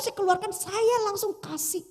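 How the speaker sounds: ordinary talk, nothing marked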